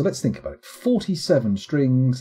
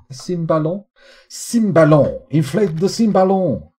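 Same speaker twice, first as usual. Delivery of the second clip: higher, hammed-up French accent; attempted French accent; 5 semitones higher, 5 dB louder than usual. Streamer guessing it's an instrument from France, mock French accent. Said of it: "my attempt at a French accent".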